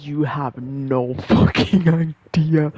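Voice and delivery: slow, stressed, emphatic insistence; mock intense insistence. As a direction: lean in and drag it out like a stoned prophet. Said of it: "and I'm like".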